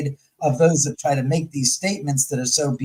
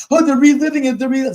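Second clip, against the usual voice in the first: much higher, louder sneering imitation; mocking quote; 10 semitones higher, 5 dB louder, much faster than usual. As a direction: mimic the know-it-alls with scorn. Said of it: "are you guys for real?".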